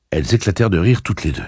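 ordinary delivery

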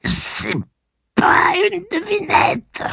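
high quavering breaking voice; a wavering, cracking boy's voice. A narrator's reading. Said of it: "chevrota presque Blaine".

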